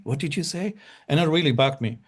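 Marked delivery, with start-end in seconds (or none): 0.0-0.7 repeated puzzled query, pitched higher and a bit softer; puzzled asking back. Retold or imitated. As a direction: echo the locals asking him to repeat. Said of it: "they said".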